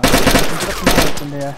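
loud complaint. Real, not acted. Complaining loudly after death.

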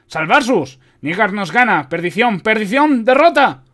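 high, loud shouts climbing to a peak; frantic alarm, building to the last word; shouting soldier. A narrator's reading. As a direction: cry out that all is lost. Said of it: "gritó el soldado".

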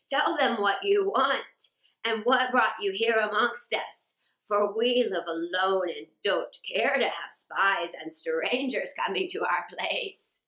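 a hint of a suspicious old father; guarded old man. A narrator's reading. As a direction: sound wary of strangers, only slightly altered from the narrator.

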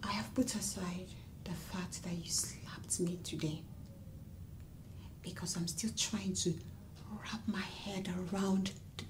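low wounded whisper; hurt whisper. Acted. Wife to husband who slapped her, hurt whisper. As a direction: keep it to a shaken whisper.

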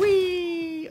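high, loud, playful whee; gleeful whee. Real, not acted. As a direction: squeal like on a slide. Jumping down in game.

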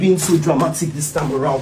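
ordinary talk, nothing marked

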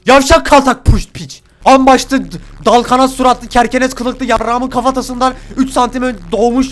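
loud, high, slowed insult tirade; furious cursing. Real, not acted. Gamer curses the enemy in a furious loud tirade. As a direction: spit every insult with rage.

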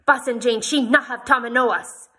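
loud, high, stubborn repetition; jealous wife shouting her point again; insisting. A narrator's reading. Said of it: "she insisted".

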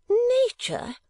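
high, drawn-out fussy matron; a scandalised old lady, very high; Mrs Nickleby. Acted.

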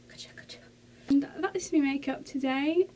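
ordinary delivery

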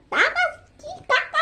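high squeaky slime voice. Acted. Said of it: "슬라임 목소리야".

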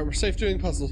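normal talking voice

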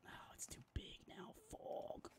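whispered note to self. Real, not acted. Streamer whispering to himself while resizing text. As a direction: murmur it under your breath.

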